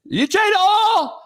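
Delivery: high, drawn-out country holler imitation; exaggerated high-pitched country accent. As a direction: mimic a country man, stretching "oil" into "awl". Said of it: "I met a country motherfucker".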